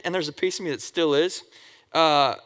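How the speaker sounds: ordinary talk, nothing marked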